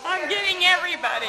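gleeful laugh while filming. Real, not acted. Cheerful kitchen filming.

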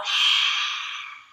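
loud, voiceless open-mouthed exhale; lion's breath sigh. Shown as a demonstration. Teacher demonstrating silly lion's breath, loud exhale. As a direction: push breath out with tongue stuck out. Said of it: "and you go".

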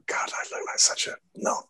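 hushed, whispery self-reproach; embarrassed aside. Retold or imitated. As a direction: whisper it to yourself, cringing. Retelling embarrassed private thought.

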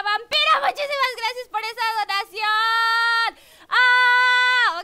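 loud, high, overjoyed thanks; excited shout. Real, not acted. Streamer yells thanks to a donor, thrilled and loud. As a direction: shout the thanks at full volume.

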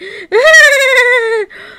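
very high, loud sustained squealing laugh; gleeful shriek. Acted. Onlooker thrilled by the coming fusion, gleeful shriek. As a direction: shriek with giddy delight, holding it high.

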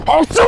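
high loud fake sneeze; sneezing. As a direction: burst out in a big sneeze. Prank sneeze after calling out.

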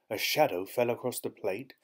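plain reading voice

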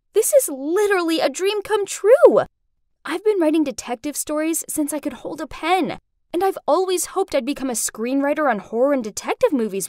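high, excited outpouring; thrilled gushing. Acted. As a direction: gush about a dream come true. Thrilled girl gushes to film director.

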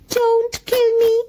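high, slow, pleading muskrat voice; almost weeping. A narrator's reading. Frightened muskrat begging the mongoose, high tearful plea. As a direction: squeak it out like a terrified little muskrat. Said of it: "almost weeping".

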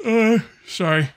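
talking through a yawn. Real, not acted. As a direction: say it mid-yawn, drawn out. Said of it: "Little yawn there".

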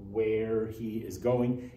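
very slow, each word laid out; slow indirect statement. Shown as a demonstration. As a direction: spell out the indirect version slowly. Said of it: "make it indirect speech".

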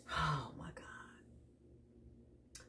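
breathless whispered oh my God; hushed gasp of wonder; awed whisper. Real, not acted. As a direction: whisper it in awe, slowly. Awed reaction to card.

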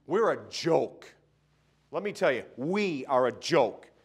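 slow, loud, every word weighted; preaching rebuke, drawn out; slow emphatic rebuke. Real, not acted. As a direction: hammer the words home. Preacher hammering a phrase.

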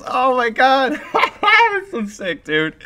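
high gleeful words breaking into laughter; giddy laughter. Real, not acted. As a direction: laugh through real amazement. Showing off both rare guns.

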